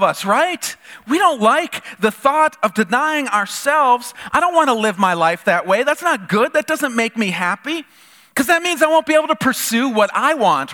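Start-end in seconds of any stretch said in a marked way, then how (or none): none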